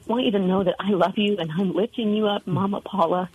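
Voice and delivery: ordinary delivery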